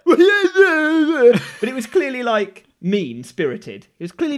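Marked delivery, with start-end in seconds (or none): 0.2-1.6 loud, high wordless taunt; mocking kid's jeer. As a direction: imitate a kid jeering as he cycles past. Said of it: "just like".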